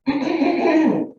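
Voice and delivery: loud rough throat clear. Real, not acted. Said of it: "Excuse me".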